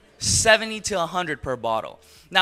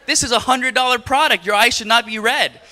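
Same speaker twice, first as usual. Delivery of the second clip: mock-outraged, loud and high; comic exasperated shout, retelling his protest; indignant outburst; 7 semitones higher, 7 dB louder than usual. Husband protesting to wife about the pricey cleanser, hammed-up indignant shout.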